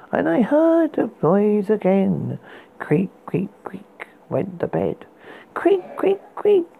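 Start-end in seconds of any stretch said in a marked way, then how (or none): 2.8-5.0 whispered creaking. Acted. Creaking bed sound imitated. 5.5-6.6 high breathy creak sounds; whispered creaking. Acted. Creaking bed sound imitated.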